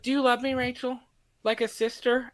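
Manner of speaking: put-on high, small voice of a pleading child; little girl. Little girl asking Rachel for love, pleading. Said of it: "this little girl has a bad dream".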